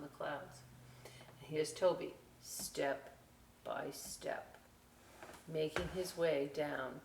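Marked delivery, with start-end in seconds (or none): 2.4-4.6 hushed, unvoiced, careful steps; whispered step by step. A narrator's reading. Storyteller whispering as Toby climbs down, hushed.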